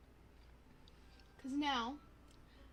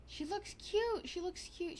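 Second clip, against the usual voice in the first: delighted; 7 semitones higher than usual. Real, not acted. Admiring the sim.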